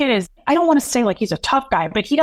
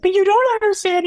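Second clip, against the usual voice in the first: imitating a desperate sobbing plea; 12 semitones higher than usual. Son defending mom to grandfather, high tearful outburst. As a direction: cry it out, pitched way up. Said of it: "to listen to Doug be this robot".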